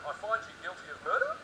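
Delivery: "murder" swung up like a question; questioning verdict. Acted. High Court judge handing down a verdict, with a questioning rise on "murder". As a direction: hand down the verdict unsure, rising on "murder". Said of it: "High Court judges in thirty years' time in Australia handing down verdicts".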